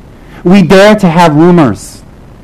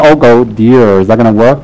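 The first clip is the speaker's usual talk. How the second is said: doubtful lama; 7 semitones lower than usual. Retold or imitated. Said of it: "and say".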